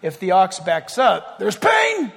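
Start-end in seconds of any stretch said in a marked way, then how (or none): 1.6-2.1 sudden high yelp of pain; mock cry of pain, high and sharp; pained yell. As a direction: yelp it like the ox just got hit. Ox backing into nails.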